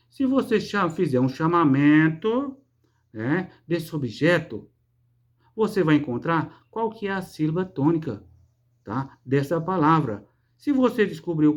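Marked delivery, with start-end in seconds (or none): none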